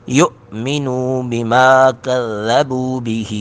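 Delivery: slow drawn-out melodic tilawat; Quran recitation. Acted. Teacher reciting the verse aloud, melodic. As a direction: chant it long and melodic.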